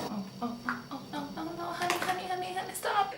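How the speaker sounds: oh no honey stop, voice climbing high; alarmed high pleading. Real, not acted.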